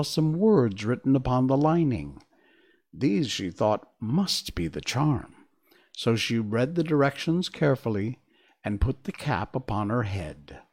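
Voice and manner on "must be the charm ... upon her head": plain reading voice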